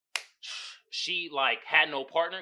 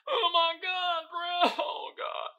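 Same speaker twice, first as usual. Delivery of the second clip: high-pitched, hammed-up sobbing wail; mock weeping; 12 semitones higher, much slower than usual. Viewer reliving crying over episode six, mock weeping. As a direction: imitate yourself bawling over a show. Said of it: "I was weeping and crying".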